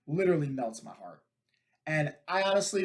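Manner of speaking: normal talking voice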